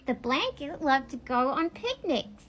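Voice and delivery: normal talking voice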